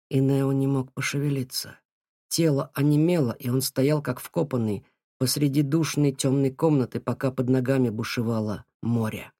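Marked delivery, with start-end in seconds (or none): none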